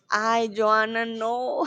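ordinary talk, nothing marked

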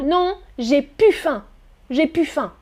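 "plus" said "pu" in "j'ai plus faim"; casual pu. Shown as a demonstration. Teacher shows very casual talk with family. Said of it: "on peut même prononcer pu".

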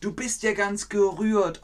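high, touched exclamation; moved, high voice. Shown as a demonstration. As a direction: sound moved and a little high. Teacher acts touched example.

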